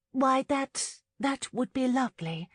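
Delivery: high, halting acceptance; stunned politeness. A narrator's reading. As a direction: accept politely, caught off guard. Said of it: "Lauren calmly responded".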